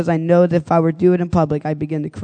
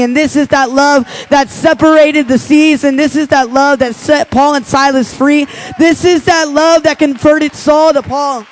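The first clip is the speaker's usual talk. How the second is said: preaching; 6 semitones higher than usual. Acted. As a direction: build the sermon to full force. Preaching climax, scripture recitation.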